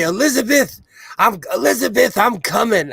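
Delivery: high calling out, clutching heart; hammed-up fake heart attack; Redd Foxx heart attack bit. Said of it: "he would say".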